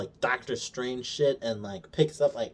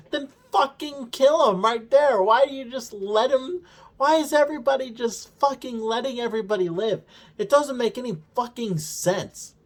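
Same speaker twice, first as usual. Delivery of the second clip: much higher, louder, disbelieving outburst; exasperated rant; 10 semitones higher, 4 dB louder than usual. Real, not acted. A fan fed up with the show, ranting in high exasperation. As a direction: vent at the writers, pitch climbing.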